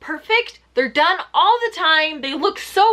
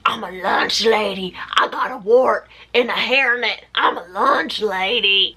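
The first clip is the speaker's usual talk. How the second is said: lower, whispered gruff lunch lady voice; 4 semitones lower than usual. Retold or imitated. Imitating Mikkel's wrestling persona, a low gruff whispered lunch lady. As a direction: growl it low like a grumpy cafeteria lady. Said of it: "who's like".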